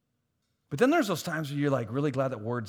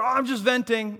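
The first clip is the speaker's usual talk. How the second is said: mock excuse; 9 semitones higher, 5 dB louder than usual.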